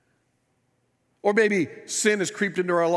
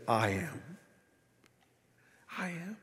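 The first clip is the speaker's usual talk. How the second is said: hushed, weighty declaration; quiet solemn assurance; 9 dB quieter than usual. Acted. Jesus to them in the midst of it, hushed. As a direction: say it softly, letting it settle. Voicing Jesus amid the storm.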